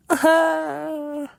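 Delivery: long, high, loud mock sob; mock wail. Told to let it out, she acts a wail. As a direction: imitate bursting into tears with a drawn-out wail.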